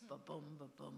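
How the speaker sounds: soft iambic ba-boom, stressing the boom; heartbeat rhythm. Shown as a demonstration. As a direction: tap out ba-boom like a heartbeat, da-DUM. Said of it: "which language was being spoken by the rhythms".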